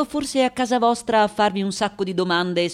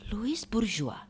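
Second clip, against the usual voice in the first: hushed, breath-only naming; barely voiced astonishment; stunned whisper; 10 dB quieter than usual. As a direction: whisper the name in disbelief. Interpreter realizes who this is.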